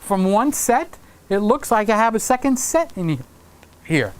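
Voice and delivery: ordinary talk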